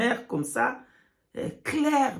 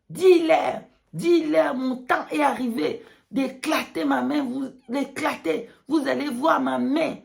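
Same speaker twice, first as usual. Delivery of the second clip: prophetic proclamation; 3 semitones higher than usual. Pretend. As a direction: preach it with rising force. God's message quoted.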